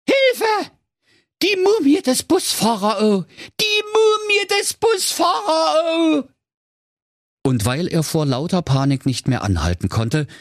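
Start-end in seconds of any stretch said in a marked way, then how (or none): 0.1-6.3 shrill high terrified shouting; panicked scream. A narrator's reading. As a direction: scream for help in blind panic. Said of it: "schrie in heller Angst". (panischer Angstschrei)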